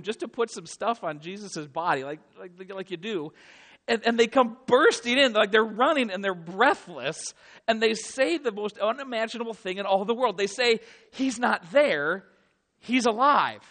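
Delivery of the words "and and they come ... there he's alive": loud, urgent, astonished news; shouting joyful disbelief at the door; breathless excitement. Acted. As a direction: burst in with the unbelievable news. Women bursting in with news.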